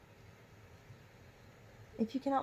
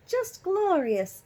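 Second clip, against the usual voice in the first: high, slow and louder, savouring it; drawn-out delight in the sunshine; glorious delight; 10 semitones higher, 6 dB louder, slower than usual. A narrator's reading. Mother in the sun, slow, high and louder.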